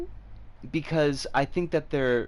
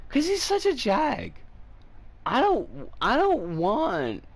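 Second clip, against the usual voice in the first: drawn-out whine, higher pitched; whiny voice; 10 semitones higher than usual. Real, not acted. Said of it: "this is me getting my whiny voice".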